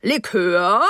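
host's called-out offer, sharply rising; calling out. A narrator's reading. Host offering guests a drink after dinner, calling out. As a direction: call the question across the table in character. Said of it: "rief Mister Rose".

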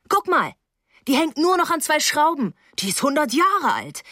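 high-pitched, agitated exclamation; indignant alarm. A narrator's reading. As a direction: point out the danger in a high voice. Rose exclaims over the dangerous stairs.

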